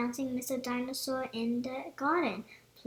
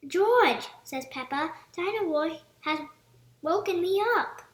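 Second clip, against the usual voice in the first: cross complaint; 4 semitones higher, 7 dB louder than usual. A narrator's reading. Peppa woken by the toy.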